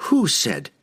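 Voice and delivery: short, pointed question; demanding. A narrator's reading. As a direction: demand an answer. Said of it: "Catterbury demanded".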